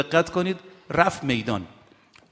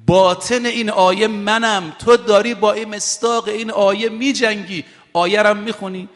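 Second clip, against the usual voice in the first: higher, forceful sermon delivery; fervent pulpit voice; impassioned preaching; 5 semitones higher, 3 dB louder than usual. Acted. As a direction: press the point home to the congregation. Preacher voicing Imam Ali's words.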